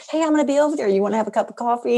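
lifted, quick cheery invitation; bright eager invite. Retold or imitated. Mom cheerily inviting her grown son to coffee, bright and quick. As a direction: sell the coffee date lightly.

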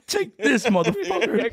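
high hammed-up tough guy; mock tough guy. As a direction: snarl it like a cartoon thug. Imagined revenge haircut scene.

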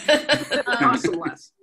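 laughing aside. Real, not acted. Joking aside.

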